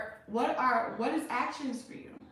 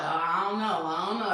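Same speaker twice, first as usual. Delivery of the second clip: mock unsure reply; 3 semitones lower, 4 dB louder than usual. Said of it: "Some of y'all like".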